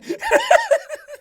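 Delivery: high loud burst of laughter; shrieking laugh. Real, not acted. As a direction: cackle loudly.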